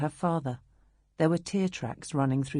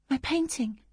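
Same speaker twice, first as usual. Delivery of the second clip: high, sudden alarm; girl remembering her painting in shock; girl's alarmed voice; 9 semitones higher than usual. Acted. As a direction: it hits her like a thunderbolt. Sudden realization after fire.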